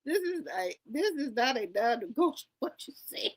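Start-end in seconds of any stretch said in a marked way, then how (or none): 2.6-3.3 unvoiced whisper; whispered aside. Real, not acted. Mocking the guest's words in a whispered aside. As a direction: drop to a sly whisper.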